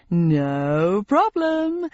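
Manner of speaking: slow sing-song game show host. Acted. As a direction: lilt it like a cheesy host. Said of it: "in his sing-song voice".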